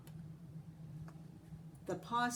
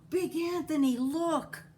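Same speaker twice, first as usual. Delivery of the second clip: alarmed call; 8 semitones higher, 7 dB louder than usual. A narrator's reading. Nun warns of overflowing pot.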